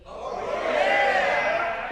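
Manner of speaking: very high, loud, stretched mock macho oh yeah; crowd-style hammed-up manly cheer, high and loud; falsetto manly yell. Speaker leads the guys' cheer, hammed-up loud. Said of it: "you're gonna say, "Oh, yeah".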